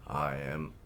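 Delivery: usual speaking voice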